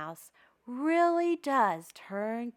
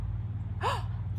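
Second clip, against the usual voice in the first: sharp breathless gasp of delight; a quick high gasp, as if thrilled; excited little gasp; 11 semitones higher than usual. Acted. Host gasps before story.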